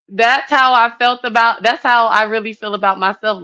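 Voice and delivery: high, loud laugh through the words. Real, not acted. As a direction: laugh it out, tickled by the memory. Laughing at the memory.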